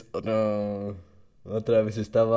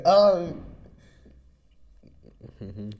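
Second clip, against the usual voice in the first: normal talking voice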